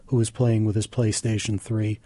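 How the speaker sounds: usual speaking voice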